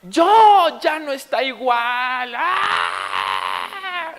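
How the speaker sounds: high, loud mock-tantrum wail; berrinche de niño imitado. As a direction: scream it like an outraged toddler. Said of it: "Fue como". (berrinche de niño chiquito, a gritos agudos)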